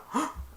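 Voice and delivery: quick startled intake of breath; mock gasp; sharp high gasp. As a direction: gasp at the ambush. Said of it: "and he went".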